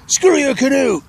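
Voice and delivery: loud, high-pitched defiant shout; defiant yell. Acted. American defying the cannibal chief, in a high yell. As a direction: yell it high as a comic last word.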